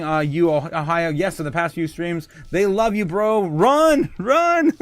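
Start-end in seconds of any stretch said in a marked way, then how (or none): 3.5-4.7 much higher and louder, teasing warning; playful cry. Real, not acted. Streamer teases a chatter, high playful yell. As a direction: yell run like a mock alarm.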